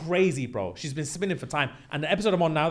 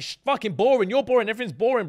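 imitating her higher-pitched complaint; mock scornful nagging; 7 semitones higher, faster than usual. Girlfriend telling her man the gym is boring, mock scornful. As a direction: nag him in a raised, put-on voice.

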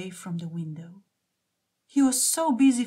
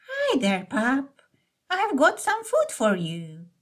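put-on kind old lady; 4 semitones higher, slower than usual. A narrator's reading. Old lady coaxes a stray.

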